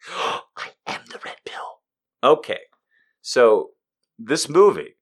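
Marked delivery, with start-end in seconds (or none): 0.1-1.8 breathy conspiratorial whisper after a gasp; dramatic whisper. Pretend. Host to co-host, whispering a dramatic mock reveal. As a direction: hush it like a big secret reveal.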